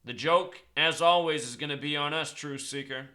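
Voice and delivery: loud, urgent rant to his viewers; a fired-up conspiracy vlogger rallying followers. Acted. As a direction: rail against the elites like a street preacher.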